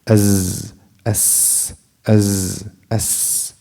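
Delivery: "As," voiced then whispered "as," in turns; alternating s demo. Shown as a demonstration. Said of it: "This is open".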